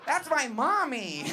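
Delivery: high, loud, drawn-out whine of outrage; mock childish protest. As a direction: protest like an overdramatic stepchild. Comic acts angsty child's protest.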